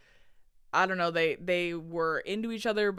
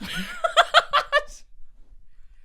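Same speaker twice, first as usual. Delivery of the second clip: laughing; 4 dB louder than usual. Real, not acted. Laughing, trailing off.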